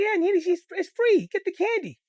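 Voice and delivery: put-on high, loud pleading kid; eager begging. Kid urging big sister to take free candy, high pleading. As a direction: beg your sister to grab it.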